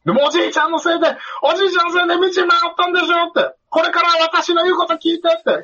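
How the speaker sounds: high, fast bossy daughter voice. Retold or imitated. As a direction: scold grandpa high and quick. Daughter takes charge, scolds.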